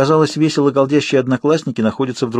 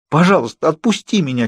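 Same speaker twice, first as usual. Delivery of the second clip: near tears; 6 semitones higher than usual. A narrator's reading. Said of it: "чуть не плакала я".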